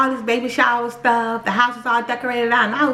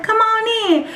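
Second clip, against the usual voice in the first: bright welcome; 11 semitones higher, slower than usual. Retold or imitated. Church lady invites her in.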